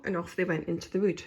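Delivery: usual speaking voice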